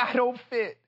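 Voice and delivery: ordinary talk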